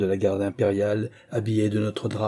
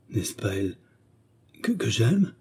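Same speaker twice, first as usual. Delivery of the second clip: stammering. A narrator's reading. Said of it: "dit le commis en balbutiant".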